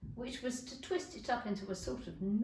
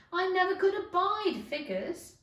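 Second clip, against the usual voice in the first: irritated snap; 8 semitones higher, 7 dB louder than usual. Acted. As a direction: wave Alice off, fed up. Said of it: "said the Duchess".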